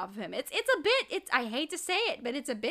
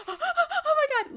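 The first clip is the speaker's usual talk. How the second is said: gasping; slower than usual. Retold or imitated. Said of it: "And I'm like, gasps".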